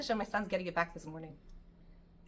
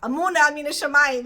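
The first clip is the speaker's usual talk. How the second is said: preachy advice; 4 semitones higher, 10 dB louder than usual. Retold or imitated. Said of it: "Everyone's telling me".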